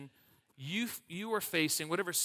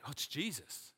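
much softer, offhand reply; soft casual answer; 8 dB quieter than usual. Pretend. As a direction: toss it off quietly, as if it's obvious. Answering who smells good.

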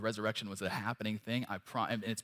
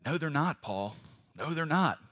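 mock objection; 5 semitones higher, 4 dB louder than usual. Mock objection to Paul's claim.

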